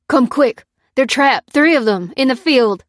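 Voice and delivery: loud, high-pitched call for help; frantic farmer raising the alarm; panicked cry. A narrator's reading. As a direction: cry out in urgent bursts. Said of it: "cried the farmer".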